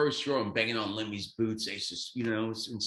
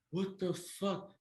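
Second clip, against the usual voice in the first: higher, slow and softer disbelief; stunned disbelief; 9 semitones higher, 6 dB quieter, much slower than usual. Retold or imitated. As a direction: drag it out, not believing your eyes. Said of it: "I'm like".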